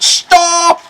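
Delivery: very high, loud, drawn-out yell; desperate shout. Acted. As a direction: yell it as if from the clouds. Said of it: "So he shouts out".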